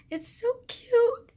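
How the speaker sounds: high, slow squealed gush. Real, not acted. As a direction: squeal it with delight. Gushing over Aquamarine.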